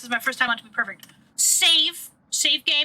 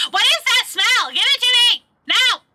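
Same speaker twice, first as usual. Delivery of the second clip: high, loud hungry demand; shrill-pitched urgent acted shout; 11 semitones higher, 8 dB louder than usual. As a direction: yell it like you need it now. Game figure demands the food.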